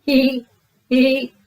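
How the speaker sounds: put-on high, loud childish giggle; giggling. A narrator's reading. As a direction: giggle like two little pigs. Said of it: "Susie and Peppa giggled".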